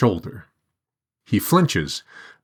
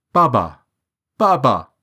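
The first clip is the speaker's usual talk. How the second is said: put-on boy's anguished cry for father; pleading cry, higher; 6 semitones higher, 4 dB louder than usual. A narrator's reading. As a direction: call out desperately for Baba. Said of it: "a boy cries".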